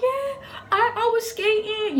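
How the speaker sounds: put-on slow, high-pitched crying child; hammed-up squeaky sobbing explanation; mock tearful. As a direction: whimper it out through pretend tears. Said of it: "you know, the tears coming down".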